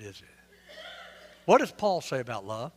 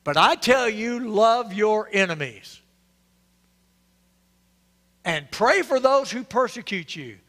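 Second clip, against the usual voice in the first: preached scripture quote; 4 semitones higher, 4 dB louder than usual. Retold or imitated. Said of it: "Jesus said".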